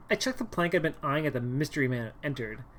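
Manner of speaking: ordinary talk, nothing marked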